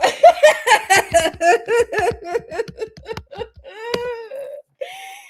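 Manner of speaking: high, loud crying laugh; helpless laughing shriek; shrieking laughter. Real, not acted. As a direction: let out a long real howl of laughter. Long laughing fit.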